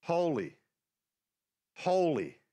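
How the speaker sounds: each holy set apart by a long pause; angels' proclamation. Acted. Seraphim around Isaiah's vision, solemnly proclaiming. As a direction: proclaim each word separately, with a falling tune. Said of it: "they're going".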